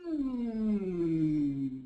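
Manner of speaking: purse the lips and hum down through the whole range; descending hum. Shown as a demonstration. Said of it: "from the top of our range to the bottom".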